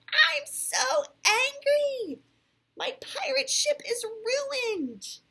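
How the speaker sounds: loud, high, drawn-out furious yell; angry yell. A narrator's reading. Alex rages over his ruined pirate ship, yelling angrily. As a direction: wail it out slowly in a child's rage. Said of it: "and yelled".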